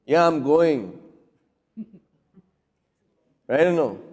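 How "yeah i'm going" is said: curt retort, falling on GOING; mildly irritated comeback; defiant reply. Shown as a demonstration. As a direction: answer back, a touch defiant. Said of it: "you're going to be responding".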